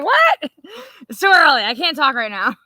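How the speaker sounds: hammed-up grouchy husband. Wife mocking her husband's morning grumbling, high and whiny. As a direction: whine it like a cranky man woken up.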